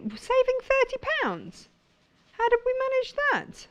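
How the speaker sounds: very high-pitched delighted squeal; excited squeaky voice. Real, not acted. Presenter thrilled by a thirty pound saving, very high squeaky voice. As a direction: voice shooting way up with excitement over the price. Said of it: "Could my voice go any higher?".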